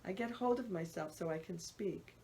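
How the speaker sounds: usual speaking voice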